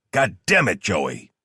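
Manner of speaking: furious cursing that falls away at the end; exasperated outburst. A narrator's reading. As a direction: lose your temper down the phone. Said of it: "while Delaney lost his shit".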